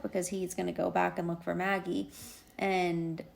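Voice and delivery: normal talking voice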